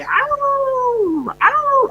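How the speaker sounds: high, long mock dog howl; a man hamming up a dog's howl for a story. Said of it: "the dog would howl every day".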